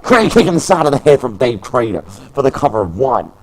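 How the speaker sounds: lively wrestling commentator calling the match; play-by-play commentary. Acted. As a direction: call every move as it lands.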